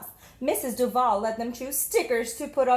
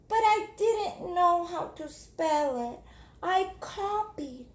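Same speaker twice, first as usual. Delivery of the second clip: put-on timid little girl; 6 semitones higher, slower than usual. Jamaica answering her teacher, timid. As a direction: answer shyly.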